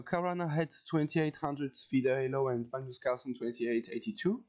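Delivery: normal talking voice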